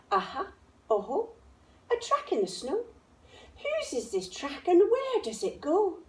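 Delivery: put-on high, eager child voice; little monster child. A narrator's reading. Gruffalo's child on a new track, high and eager. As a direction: chase the track gleefully.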